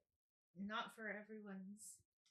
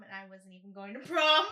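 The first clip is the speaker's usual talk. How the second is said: loud, laughing confession; 22 dB louder than usual. Real, not acted. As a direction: laugh at yourself. Laughing at her old video.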